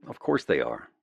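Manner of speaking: usual speaking voice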